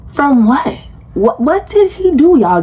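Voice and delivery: what did he even do, stunned; incredulous. Real, not acted. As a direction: stretch it out in disbelief. Disbelief at "I learned so much.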